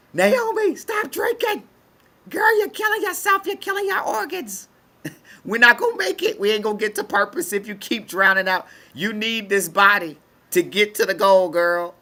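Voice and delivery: a high voice scolding her own body; voice of the spirit. Retold or imitated. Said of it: "It's like".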